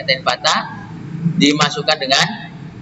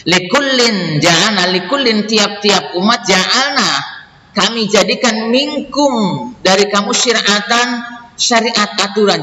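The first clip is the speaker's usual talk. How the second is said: raised, slow, measured recital of verse and gloss; verse reading; 7 semitones higher, slower than usual. Acted. Teacher reading scripture and translation for students, slow measured recital. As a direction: read each phrase deliberately in a lifted voice.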